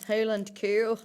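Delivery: clumsy attempt at a Scottish accent; awful Scottish accent. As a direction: slip briefly into a bad Scottish accent. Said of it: "that was an awful Scottish accent".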